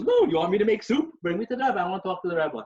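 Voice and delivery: high and loud, mock indignant; demanding wife. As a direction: insist on seeing the Rebbe. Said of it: "she goes".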